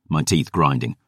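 ordinary delivery

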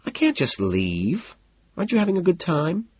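wheedling hostess; sighing reluctance. A narrator's reading. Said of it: "she sighs".

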